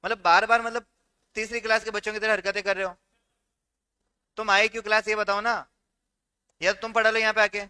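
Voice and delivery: loud, fed-up rebuke of the class; angry scolding. Real, not acted. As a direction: tell them off loudly, out of patience. Teacher scolding disruptive students. (झुँझलाकर ज़ोर से डाँटना)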